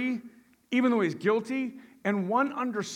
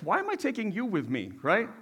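ordinary talk, nothing marked

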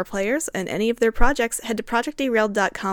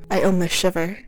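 ordinary talk

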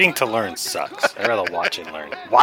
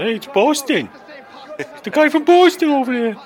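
higher, louder mock tourist calling out; mock resort stranger; 5 dB louder than usual. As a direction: call across the resort like a goofy stranger.

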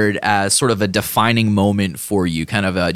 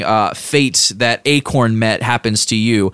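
ordinary talk, nothing marked

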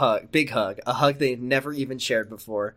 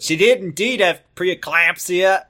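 higher, hammed-up Australian accent; mimicked Australian accent; 5 semitones higher, 3 dB louder than usual. Co-reader echoes the doctor's diagnosis, mock Australian accent.

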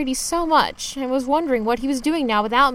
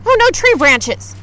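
loud, higher rapid heck heck; panicked; 4 semitones higher, 5 dB louder than usual. Acted. Driver hitting tree branches, panicked repeated cries.

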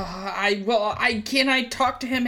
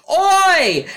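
loud call across the room; shout; 9 semitones higher, 8 dB louder than usual. Retold or imitated. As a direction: call out the name sharply.

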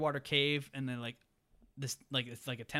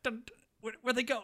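high baffled voice; confused dad; 10 semitones higher than usual. Retold or imitated. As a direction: sound totally lost and surprised. Dad confused after teeth smashed.

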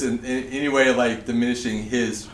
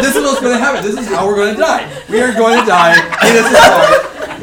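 loud, high-pitched retold panic; acted-out certainty of doom; panicked conviction; 8 semitones higher, 9 dB louder, faster than usual. Pranked friend convinced he will die, loud panic. Acting out friend expecting death.

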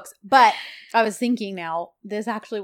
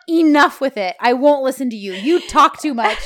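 loud, higher mock-angry outburst; retold snapping; 4 semitones higher, 9 dB louder than usual. Man silencing the woman arguing with him, mock fed-up snapping. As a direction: cut her off as if fed up, loudly. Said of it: "John done snapped".

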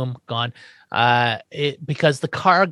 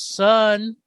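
high, sing-out dad voice; excited calling; 6 semitones higher, slower than usual. Retold or imitated. Herman coming in looking for his new son, excited calling. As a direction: call out eagerly for your boy, pitched up. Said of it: "He's very excited".

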